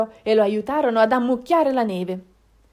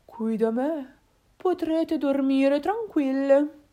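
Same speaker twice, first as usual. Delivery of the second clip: slow, drawn-out cow voice, slightly high; amiable, unhurried cow; friendly cow; 4 semitones higher, slower than usual. Acted. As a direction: welcome them in slowly and kindly. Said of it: "ma disse amichevolmente".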